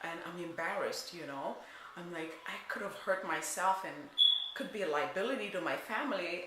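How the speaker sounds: hushed and shaken confession; scared, hushed. Retold or imitated. Woman recalling fearing for her life, hushed and scared. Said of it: "I was scared for my life".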